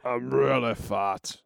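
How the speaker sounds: slow, low put-on voice; comic lumbering delivery; fat Ivan. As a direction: confess it heavily and slowly. Voices overfed Ivan as joke.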